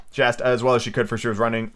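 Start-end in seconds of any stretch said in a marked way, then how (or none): none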